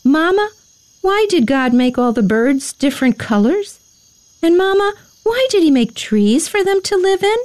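put-on high, questioning child voice; little boy's eager bedtime questions, high and lilting; curious little boy. A narrator's reading. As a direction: ask like an inquisitive small boy, pitched high. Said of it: "He had so many questions".

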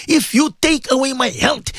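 ordinary talk, nothing marked

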